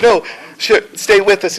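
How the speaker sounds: high, loud acted plea; urgent plea. Friends to the dying general, high urgent mock plea. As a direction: beg the dying man to hold on. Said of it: "they're like".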